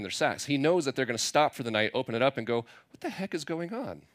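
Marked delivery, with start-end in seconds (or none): 2.9-4.0 hushed, higher-pitched puzzlement; mock hushed bafflement; acted bewilderment. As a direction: voice their baffled reaction, quietly and high. Brothers open the sacks, bewildered.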